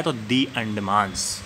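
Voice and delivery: slow, soft "दी अंडमान्स" with a long "thee"; the before vowel. Shown as a demonstration. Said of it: "द्वीप समूह के पहले article लगता है".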